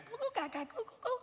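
put-on soft, high-pitched baby cooing; tiny, high gurgling coos; baby babble. Baby calm again, cooing.

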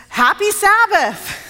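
high, slow, drawn-out greeting; hearty greeting. Retold or imitated. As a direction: imitate an eager visitor's big hello. Mayor proudly greets the pastor.

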